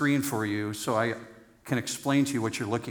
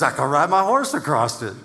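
hammed-up Australian farmer, louder and laughing; Australian accent; 6 dB louder than usual. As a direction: boast like an Aussie farmer, grinning through it. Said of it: "Uh, hear this".

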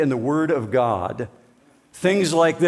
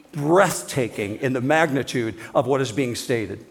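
normal talking voice

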